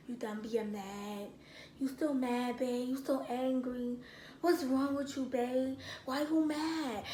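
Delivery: soft, hushed imitation of a patient boyfriend; calm coaxing. Boyfriend close to girlfriend's face, calm and soft. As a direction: gently check on a sulking partner. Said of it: "He's the most calmest person".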